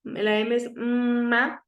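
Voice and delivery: "ma" stretched out slowly; slow letter-sound demo of "ma"; teacher sounding out "ma" slowly. Shown as a demonstration. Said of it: "Ustedes saben que la P es".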